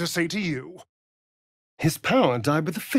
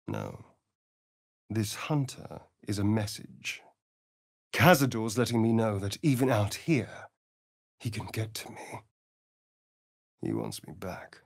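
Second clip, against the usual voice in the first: quiet dread; 4 semitones lower, 4 dB quieter than usual. Acted. Realizing his master hunts him.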